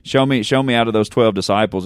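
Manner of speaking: ordinary talk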